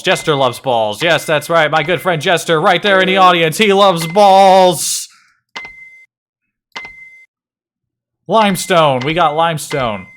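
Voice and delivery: milk it like a ringmaster; big carnival barker hyping his friend Jester; showman voice. Pretend. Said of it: "I can't keep doing the voice".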